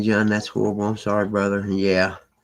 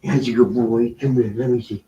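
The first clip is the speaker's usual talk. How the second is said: ordinary delivery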